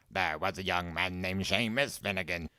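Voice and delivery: understated put-on recital; quoting the song. A narrator's reading. Door voice reminding Seamus of his single, reciting. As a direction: recite the rhyme at ordinary pitch in a put-on voice.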